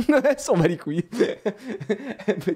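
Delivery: high, giggling through the words; laughing. Real, not acted. Dad laughing about his daughter's indifference. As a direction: laugh while telling it.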